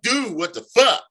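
retold startled outburst, high and loud; startled protest. Man reacting to someone at the door, startled protest.

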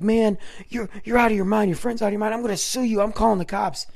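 high, agitated threats tumbling out; indignant outburst. Retold or imitated. Punched clown threatening to sue and call cops, indignant. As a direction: imitate an outraged man who was just hit.

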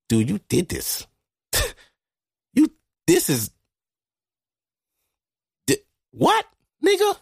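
incredulous self-talk, soaring up by the end; amazed at himself. Retold or imitated. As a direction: pitch climbing into disbelief. Amazed at himself looking back.

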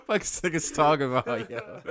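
laughing. Real, not acted. Laughing at friend's take.

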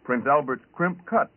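normal talking voice

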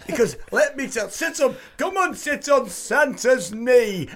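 high, loud hammed-up northern Santa; northern creepy Santa. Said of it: "northern creepy Santa".

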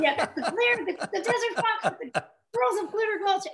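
giggling as she plays along with the joke; laughing. Real, not acted. Guest laughs as she repeats the host's Desert Fox joke.

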